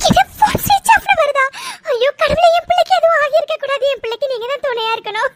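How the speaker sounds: high, crying wail of dismay; tearful panic. Acted. Mother hears her son's phone is off, tearful panic. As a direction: cry out to God in distress. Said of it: "நீங்க அழாதீங்கம்மா".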